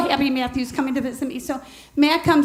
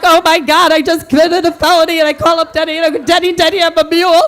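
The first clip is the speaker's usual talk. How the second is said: panicked; 3 semitones higher, 8 dB louder than usual. Retold or imitated. Widow calls Denny after finding weed, loud panic. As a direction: blurt it out in a frantic rush.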